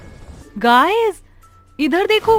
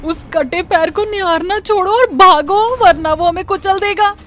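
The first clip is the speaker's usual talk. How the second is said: high, loud urgent shout; panicked warning; 10 semitones higher, 6 dB louder than usual. Acted. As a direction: yell at your friends to run for it. Urging friends to flee. (घबराकर ऊँची आवाज़ में चिल्लाना)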